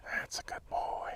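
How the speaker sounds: breathy whisper of praise to the dog. Real, not acted. Trainer whispers praise to the dog, whispered. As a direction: hush it, just for the dog.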